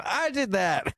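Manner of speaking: mock Biden bragging; proud Biden. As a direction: announce it like a small triumph. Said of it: "coming out of the bathroom".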